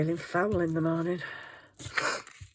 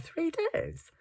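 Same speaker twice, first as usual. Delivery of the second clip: high, soft disbelieving question; incredulous; 12 semitones higher, 6 dB quieter than usual. Retold or imitated. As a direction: doubt what you heard. Incredulous reply to chemist.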